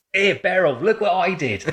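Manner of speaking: put-on high-pitched, playful old-timer showing off; hammed-up elderly boasting; nursing home resident. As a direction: call a friend over to admire your photos. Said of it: "You'd be sat there in the nursing home".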